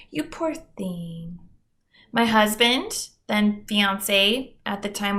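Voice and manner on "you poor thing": pity voice. Retold or imitated. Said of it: "I don't know if they said baby".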